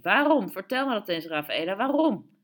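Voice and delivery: high, loud outburst, peaking on the question word; shouted demand. A narrator's reading. Tito confronts Rafaela while swinging the chicken, shouted demand. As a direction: cry out for an explanation. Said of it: "en riep".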